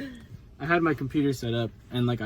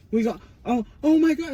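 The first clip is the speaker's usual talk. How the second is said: put-on panicked kids; 13 semitones higher, 7 dB louder than usual. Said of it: "They're like".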